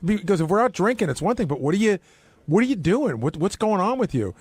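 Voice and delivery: ordinary talk, nothing marked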